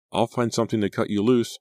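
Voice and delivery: high-pitched, anxious promise; urgent reassurance. A narrator's reading. Frightened girl hurrying to free friend.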